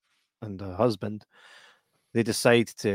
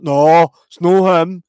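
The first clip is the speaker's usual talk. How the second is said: loud, slow, higher mock denial; hammed-up denial; 7 semitones higher, 11 dB louder, slower than usual. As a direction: deny it far too loudly. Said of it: "But I was like".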